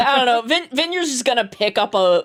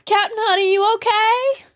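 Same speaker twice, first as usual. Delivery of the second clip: concerned character; 11 semitones higher than usual. Acted. Checking if Captain is okay after the attack, concerned. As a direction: call over high and sweet to check on them. Player calls to another character.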